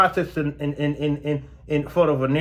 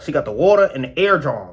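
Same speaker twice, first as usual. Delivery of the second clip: ordinary talk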